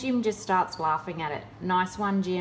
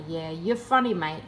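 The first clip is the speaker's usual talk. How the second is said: ordinary talk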